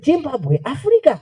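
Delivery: put-on high-pitched, amazed Japanese-accented echo; mock Japanese child. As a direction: imitate a Japanese kid's astonishment.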